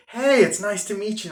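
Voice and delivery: dad's mock-cheerful welcome to the friend; fake friendly. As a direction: greet the friend with put-on sweetness. Dad fake-friendly to daughter's friend.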